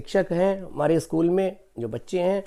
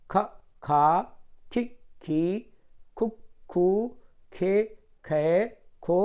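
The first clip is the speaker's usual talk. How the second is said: syllable chart recitation; 3 semitones higher, much slower than usual. Shown as a demonstration. Teacher reciting the syllable chart to children, slow sing-song. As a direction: chant the barahkhadi slowly for young pupils.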